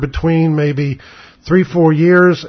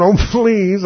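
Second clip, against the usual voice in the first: high, scoffing plea broken by a laugh; laughing disbelief; 6 semitones higher than usual. Retold or imitated. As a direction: retell it laughing, pitched up. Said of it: "I swear I laughed".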